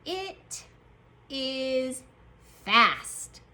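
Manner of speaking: IT, IS, FAST with a pause after each; word-by-word dictation. Shown as a demonstration. Teacher dictates the next sentence, word by word. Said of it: "Our next sentence is going to say".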